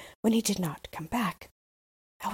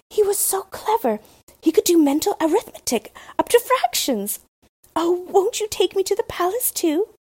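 put-on higher, eager child's plea; eager little Gerda; 11 semitones higher, 5 dB louder than usual. Gerda begging the Crow to take her, in a higher eager voice. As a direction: beg to be taken along.

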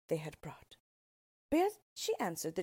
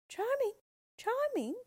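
a far-off voice calling, much higher and louder; 4 dB louder than usual. A narrator's reading. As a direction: call his name across the river. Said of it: "heard someone calling".

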